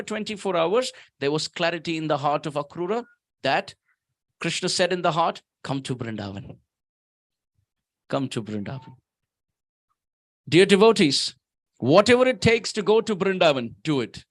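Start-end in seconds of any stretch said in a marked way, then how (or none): 5.6-9.0 low and soft, a gentle call; soft inner voice. Acted. Krishna calling Akrura softly in his heart.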